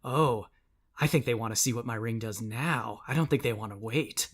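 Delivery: soft, low-key threat; quiet villainess savouring the threat; quietly menacing Tira. Acted. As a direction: toy with them in a hushed voice. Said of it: "Tira is, uh, spinning this large bladed ring".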